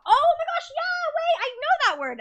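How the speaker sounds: very high, very loud glee; excited squeal. Real, not acted. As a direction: squeal with delight. Recognises a French word.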